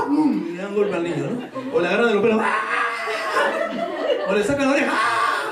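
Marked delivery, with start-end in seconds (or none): 2.4-4.3 high mock yelp of a child in pain; hammed-up high cry with a laugh; mock child scream. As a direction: squeal like a kid getting his hair yanked. Acts out child's hair pulled.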